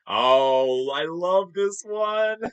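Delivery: high, slow, gushing delight; light up over the favorite photo; excited. Real, not acted. A fan shows his favorite photo to viewers, thrilled and gushing.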